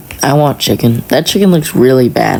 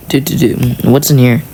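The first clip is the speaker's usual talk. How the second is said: ordinary delivery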